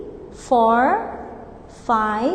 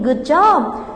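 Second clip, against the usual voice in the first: ordinary talk, nothing marked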